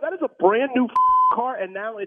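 furious car owner yelling at the manager; angry outburst. Real, not acted. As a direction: demand answers about the stolen car. Prank victim yelling at manager.

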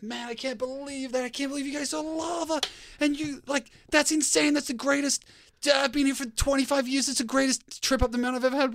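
high-pitched, astonished retelling of the guide; amazed guide. Retold or imitated. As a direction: mimic the guide gushing in disbelief. Guide amazed by lava sighting.

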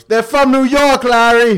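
high, loud, drawn-out Boston holler; thick Boston accent. Retold or imitated. Bartender hollering to Larry about the visitors, thick Boston accent. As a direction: bellow it slowly in broad Boston. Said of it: "had the thickest Boston accent in the world".

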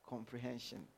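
ordinary talk

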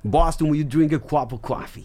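hammed-up Boston accent in English. Shown as a demonstration. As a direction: show off a Boston accent for laughs. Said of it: "Boston tiene un acento".

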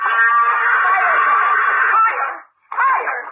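shouting out. Acted. Swinging, crying out to go higher.

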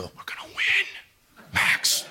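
hushed, slow, breath-only vow; intense whisper. Retold or imitated. Dad vowing to his son mid-round, intense whisper.

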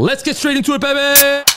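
very high, loud hype shout into the show; excited host hype call; hype intro call. Acted. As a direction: kick off the podcast with a big hype yell.

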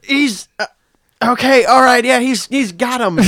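high, loud excited ringside commentator; wrestling commentary. Retold or imitated. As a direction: call the match like a frantic announcer. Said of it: "doing our own commentary".

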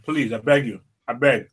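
talking through food; mumbled with a mouthful; mouth full. Real, not acted. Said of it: "I know your mouth is full of food".

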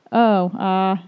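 drawn-out, reluctant agreement; imitated awkward admission. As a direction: act out a sheepish friend owning up. Friend's awkward admission, retold.

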